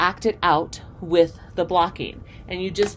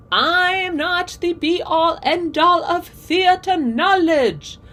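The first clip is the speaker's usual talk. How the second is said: high, slow, grandly pompous mock voice; mock grandiose; 11 semitones higher, 6 dB louder, slower than usual. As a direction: puff it up like a self-important know-it-all, tongue in cheek. Mock-modest self-deprecation.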